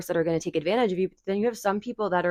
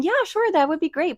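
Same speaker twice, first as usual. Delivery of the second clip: much higher and louder, forced cheer; fake eager yes; 11 semitones higher, 5 dB louder than usual. Telling a friend yes you don't mean, high forced cheer. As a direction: squeal a fake delighted yes. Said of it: "so you're saying".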